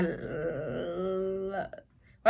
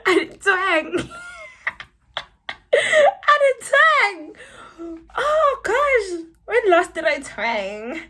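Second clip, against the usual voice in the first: high, loud squeal through laughter; laughing disbelief; 13 semitones higher, 7 dB louder, slower than usual. Real, not acted. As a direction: protest while cracking up, voice shooting up. Cracks up over her own twang.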